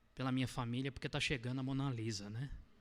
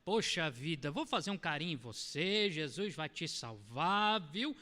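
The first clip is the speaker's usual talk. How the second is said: high, syrupy coddling voice, stretched out; hammed-up sickly sweet pity; mock sugary baby talk; 7 semitones higher than usual. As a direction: coo at them like a doting parent to a small child. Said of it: "falar com amor hoje é você chegar".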